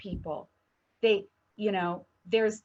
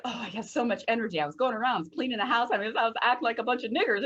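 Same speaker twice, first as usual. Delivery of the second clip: fast, higher-pitched gushing retelling; boasting mother; 4 semitones higher, much faster than usual. Retold or imitated. Friend's mother boasting about her vitamins, breathless gushing. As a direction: rattle it off like an energized, boasting mom. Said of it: "vitamins that she was boasting about".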